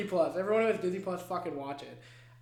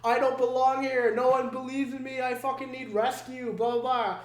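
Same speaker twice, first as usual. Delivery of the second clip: put-on whiny teen plea; 6 semitones higher, 5 dB louder than usual. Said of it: "He's like".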